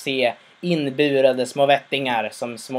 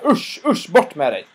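much higher disgusted usch, then shooing; 3 semitones higher, 3 dB louder than usual. Retold or imitated. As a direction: chase him off in disgust. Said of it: "alla bara".

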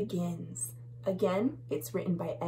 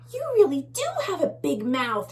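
fast, higher bug voice; little bug; 8 semitones higher, 3 dB louder, faster than usual. Acted. Little bug teasing the bully, quick and high. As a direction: rattle it off like a tiny bug. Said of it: "says one of the little bugs".

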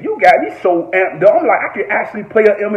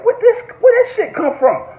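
astonished quote. Retold or imitated. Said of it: "I said".

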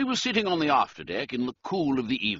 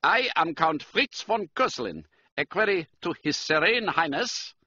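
higher-pitched German count; German-accented aristocrat, pitched up; 8 semitones higher, 3 dB louder than usual. A narrator's reading. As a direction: address him formally in a German accent. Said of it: "He spoke with a German accent".

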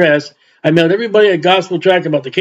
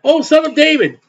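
imitated crying out; 7 semitones higher than usual. Gentile woman calling to Jesus, imitated high cry. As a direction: call out higher without shouting. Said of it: "when she cried".

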